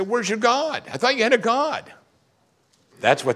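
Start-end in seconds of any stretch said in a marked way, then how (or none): 0.0-1.9 high taunting mock voice; a sneering taunt, much higher; mocking unbeliever. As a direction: jeer it like a scoffer. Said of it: "And they say".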